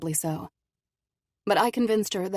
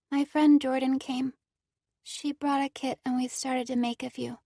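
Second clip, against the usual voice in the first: put-on higher, slightly slurred girl; young girl voice; 8 semitones higher than usual. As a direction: speak as an injured girl. Cora's girl voice.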